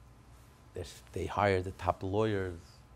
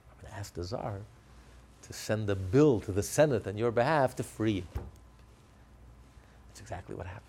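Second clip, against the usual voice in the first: ordinary talk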